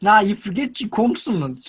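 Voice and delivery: normal talking voice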